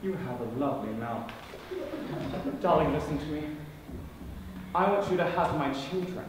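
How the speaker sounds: low, soft seductive murmur; intimate wooing. Acted. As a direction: woo her very softly and low.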